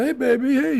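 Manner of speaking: put-on high, sugary "hey baby" to a toddler; sweet grandpa voice. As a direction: coo innocently at the little girl. Grandpa hiding joint from toddler.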